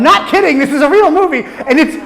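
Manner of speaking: can't stop laughing at how bad it is; amused disbelief, slow and laughing. Real, not acted. Laughing while insisting it's real.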